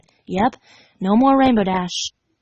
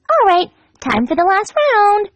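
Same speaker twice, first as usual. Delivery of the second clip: much higher, slow cheerful pony voice; Pinkie voice; 10 semitones higher, 4 dB louder than usual. Acted. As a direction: sing it out sweetly, slowly.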